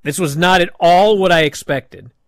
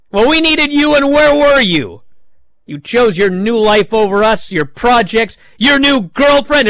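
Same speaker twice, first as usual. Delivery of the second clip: retold angry yell; 7 semitones higher, 5 dB louder than usual. Woods keeps accusing Big E.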